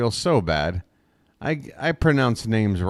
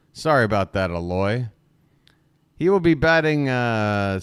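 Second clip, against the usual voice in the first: ordinary talk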